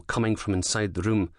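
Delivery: ordinary delivery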